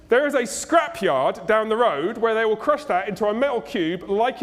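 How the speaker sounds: loud, high, indignant rant; hammed-up scornful tirade, hitting word after word; outraged shop owner. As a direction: throw them out in mock outrage. Acts edgy reformed repair shop owner.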